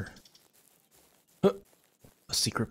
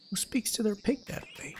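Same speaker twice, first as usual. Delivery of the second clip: squeaky voice; 12 semitones higher than usual. Acted. Voicing game character reaction.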